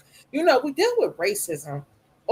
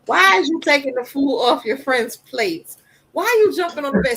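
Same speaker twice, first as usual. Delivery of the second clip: higher, louder mock scolding of a kid; 6 semitones higher, 6 dB louder than usual. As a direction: scold the boy playfully, quickly. Mother scolding son Julian.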